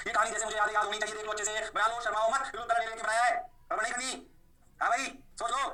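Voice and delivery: usual speaking voice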